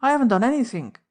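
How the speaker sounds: Cockney chauffeur crying out his innocence; a loud, hurried Cockney plea. A narrator's reading. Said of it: "he cried".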